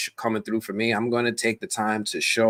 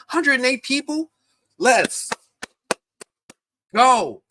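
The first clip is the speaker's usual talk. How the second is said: high-pitched hyped cheer; thrilled, high-pitched shout-out; excited cheer; 12 semitones higher, 4 dB louder, slower than usual. Real, not acted. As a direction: celebrate the crowd, loud and high. Cheers the viewer count.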